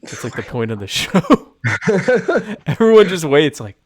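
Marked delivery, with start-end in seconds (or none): 1.2-2.6 big burst of laughter; loud high laugh. Real, not acted. Laughs at own joke.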